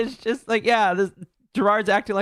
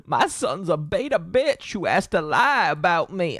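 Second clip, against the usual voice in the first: slow, lightly done Southern drawl; a hint of a Southern dad; southern accent. Streamer voicing the dad in a light Southern accent. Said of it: "giving him, like, a southern accent".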